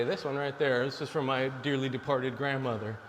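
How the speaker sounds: ordinary talk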